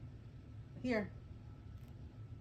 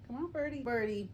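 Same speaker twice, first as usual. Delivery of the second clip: ordinary delivery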